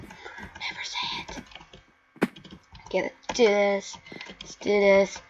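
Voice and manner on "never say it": unvoiced slow whisper. Real, not acted. Gamer warning viewers, slow whisper. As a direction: breathe it as a secret.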